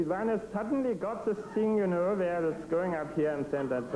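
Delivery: hint of Strangelove's German accent, slowed; German accent, high voice. Shown as a demonstration. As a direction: put a German accent over a small high voice. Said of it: "if I put a German accent on top of that".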